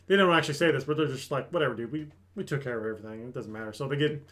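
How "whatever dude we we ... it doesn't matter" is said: lower, trailing off softly; lightly imitated weary brush-off; mock dismissive. Tired party walking past reinforcements, mildly dismissive.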